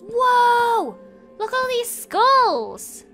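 high, loud amazed cry; thrilled discovery, voice jumping up; excited. Real, not acted. Finds hidden skull tomb.

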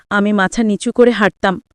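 plain reading voice

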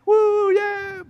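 high, loud victory whoop; mock cheering. Crowd having a great time on parade, mock cheering. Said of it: "And they're cheering".

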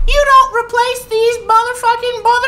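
very high, squeaky mock boss voice; a cartoonish manager's shriek. Streamer mocking the managers, in a squeaky cartoon shriek. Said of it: "the managers have been like".

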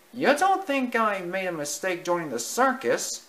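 high, unsure little mouse; worried mouse voice. Acted. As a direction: ask it hopefully. Timothy asks his parents.

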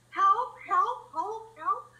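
high, loud cries for help; frantic cries for help; calling for help. Acted. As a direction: shout for help in panic.

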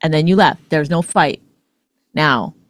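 ordinary talk

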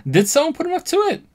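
high, loud and excited; excited outburst. Real, not acted. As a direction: blurt it out, amazed.

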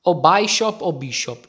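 ordinary delivery

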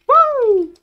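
long, high falling "woo"; high whoop. Real, not acted. Whoop while playing.

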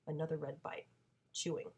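ordinary delivery

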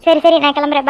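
ordinary delivery

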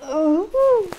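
loud, high sneeze; real sneeze. Real, not acted. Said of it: "when I do the sneeze".

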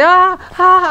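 high mock scream; quick, put-on shrieks; imitated scream. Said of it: "Me estaba gritando en plan de".

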